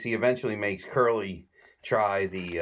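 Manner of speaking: normal talking voice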